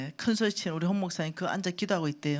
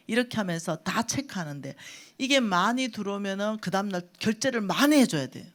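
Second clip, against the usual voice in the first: usual speaking voice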